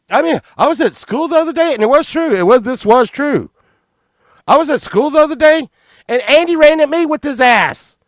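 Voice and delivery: high, urgent retold boyish protest; mock indignant testimony. Boy tells cops about his friend at school, mock indignant. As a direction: pile on with hammed-up outrage.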